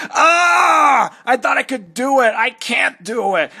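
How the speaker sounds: high, loud scream into despair; hammed-up shriek of horror fading to despair; anguished scream. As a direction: scream then give up in horror. Character despairs over babies.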